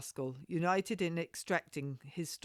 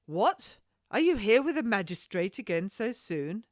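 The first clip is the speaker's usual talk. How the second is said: sharp, raised, haughty challenge; indignant lady confronting the rascal; 5 semitones higher, 7 dB louder than usual. Acted. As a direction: snap at the cocky captain, voice higher and louder. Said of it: "I said haughtily".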